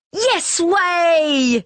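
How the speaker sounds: cheeky mock dog answer; dogs calling. A narrator's reading. Street dogs call back to the cats. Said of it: "call the street dogs".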